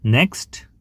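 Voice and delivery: ordinary talk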